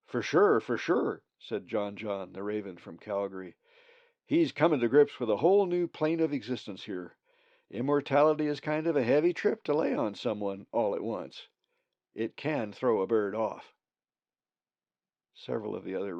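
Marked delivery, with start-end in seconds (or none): 0.1-1.2 louder, easygoing hippie greeting; a mellow Calgary raven saying far out; laid-back hippie. A narrator's reading. John John agrees. 4.3-13.7 a hippie raven explaining heavy trips; laid-back hippie. A narrator's reading.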